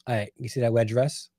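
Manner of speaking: usual speaking voice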